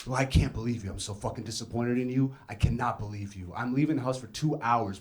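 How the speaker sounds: low, soft, mildly acted disappointment; hint of pissed mom. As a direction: lightly voice a let-down, scolding mother. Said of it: "obviously my mom was pissed".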